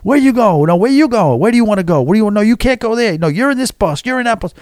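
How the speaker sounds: lightly done Danny DeVito in Taxi bossing people around; Danny DeVito dispatcher. Retold or imitated. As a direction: imitate a harried taxi dispatcher working a walkie-talkie. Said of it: "like she's freaking Danny DeVito in Taxi".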